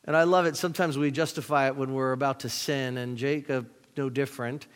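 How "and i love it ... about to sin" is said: ordinary talk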